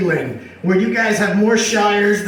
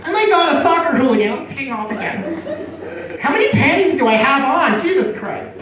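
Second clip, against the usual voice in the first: tag; 5 semitones higher than usual. Acted. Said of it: "American girls, they're just like".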